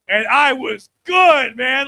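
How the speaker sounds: slow, loud boast; proud boast. Acted. Comic bragging to the crowd about his game, slow and loud. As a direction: stretch it out, proud of yourself.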